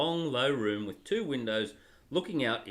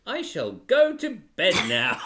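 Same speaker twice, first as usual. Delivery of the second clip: laughing, louder regal decree; royal pronouncement; 4 semitones higher, 8 dB louder than usual. Acted. Father voicing Peter as a grand royal decree. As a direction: declare it like a pompous young king. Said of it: "comes the royal pronouncement".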